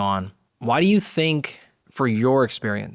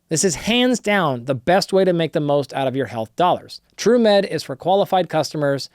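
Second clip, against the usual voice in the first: brisk sponsor read, a bit pushed up; 3 semitones higher than usual. Acted. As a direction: sell it like a podcast ad spot. Host reads sponsor ad.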